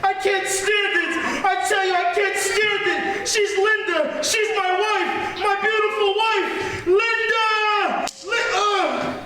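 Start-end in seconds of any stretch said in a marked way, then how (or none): 0.0-8.1 raised, desperate wail; anguished outburst. Acted. Husband breaking down over his transformed wife, anguished. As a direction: cry out as if unable to bear it.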